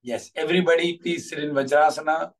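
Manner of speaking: usual speaking voice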